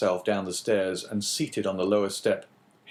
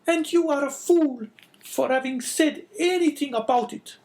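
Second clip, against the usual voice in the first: much higher, louder, harsh woman's voice; sickly scolding wife; 6 dB louder than usual. A narrator's reading. Wife calling down the stairs at husband, scolding. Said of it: "said a voice from the top of the stairs".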